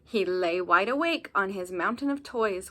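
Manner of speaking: ordinary delivery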